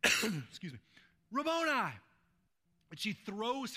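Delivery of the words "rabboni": loud, high cry to God; impassioned raised-pitch exclamation. Acted. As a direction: call out the name high and loud. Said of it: "crying out to God".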